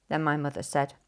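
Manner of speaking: ordinary delivery, nothing marked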